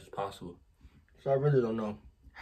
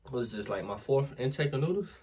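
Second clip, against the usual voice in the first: ordinary talk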